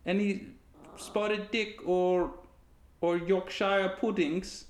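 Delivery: high, slow mock British voice; British accent. Asking Leslie for puddings, high slow British accent. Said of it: "was a British accent".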